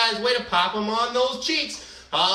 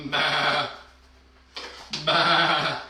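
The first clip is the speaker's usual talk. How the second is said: bleating sheep sound; sheep baa; 5 semitones lower than usual. Pretend. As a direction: bleat like a little lamb. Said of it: "baa like a sheep".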